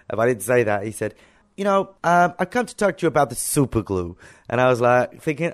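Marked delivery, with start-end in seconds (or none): 1.6-4.2 higher-pitched imitation American accent. FBI agent explaining his visit, imitated American accent. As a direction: put on a rough American accent. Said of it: "sorry for my American accent".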